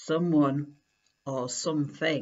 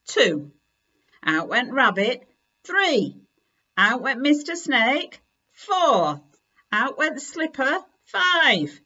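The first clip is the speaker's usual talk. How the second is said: louder and louder cross counting; 4 semitones higher, 6 dB louder than usual. Acted. Kipper throwing his toys out, loud and cross. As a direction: fling each toy out with the number.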